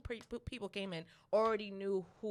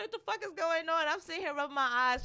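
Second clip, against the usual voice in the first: higher-pitched, acted-out confusion; retold bewilderment; 7 semitones higher than usual. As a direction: replay being baffled on waking up. Retelling her bewildered morning-after reaction.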